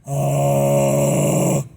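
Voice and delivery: deep, loud fry growl; very low distorted oh. Shown as a demonstration. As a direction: sink to the bottom and rattle. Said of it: "the lowest possible tempo".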